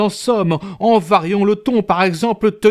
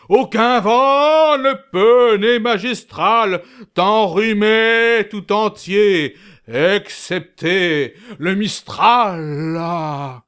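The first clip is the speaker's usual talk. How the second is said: emphatic; 3 semitones higher, slower than usual. Acted. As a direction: declaim each word hugely.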